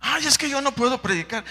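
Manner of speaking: high, loud whiny excuse. Acted. Preacher mimics a believer excusing himself, whiny and high. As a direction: whine like a believer making excuses.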